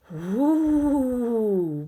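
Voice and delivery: a failed boo that comes out as a hoot; a ghost trying to say boo, hooting instead; owl-like whoo. Acted. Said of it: "Ben's voice bounced off the tall pine trees".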